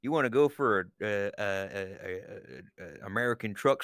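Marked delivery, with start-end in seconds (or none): none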